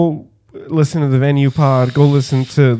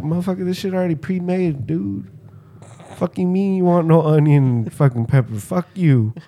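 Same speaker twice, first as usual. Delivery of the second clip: higher-pitched mock indignation; mock annoyed; 6 semitones higher than usual. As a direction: play a peeved deli guy without shouting. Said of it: "they get all mad at you".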